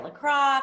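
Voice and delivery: LACROIX said as la-CRAH, French-like open ending; common mispronunciation. Shown as a demonstration. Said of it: "people pronounce it wrong".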